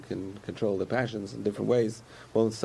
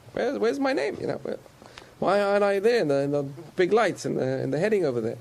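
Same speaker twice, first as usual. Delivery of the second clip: much higher, plaintive voice of a slighted king; King David complaining; 8 semitones higher than usual. Retold or imitated. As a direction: whine like he was left off the bill. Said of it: "He says".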